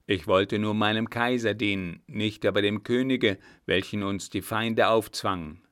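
lightly voiced soldier, emphatic and proud; loyal captain. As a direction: declare allegiance firmly and loudly.

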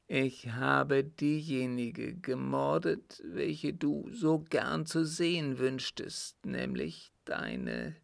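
halting confession, breaking off before the worst; anguished dying father. Acted. Father confessing crimes to his son, halting and tormented. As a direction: force each admission out with long pauses.

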